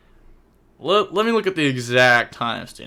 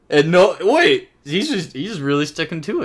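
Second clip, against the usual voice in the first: lingering disbelief; 5 semitones higher, 6 dB louder than usual. Real, not acted. Still reacting in disbelief.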